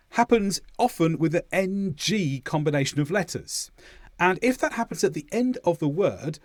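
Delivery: normal talking voice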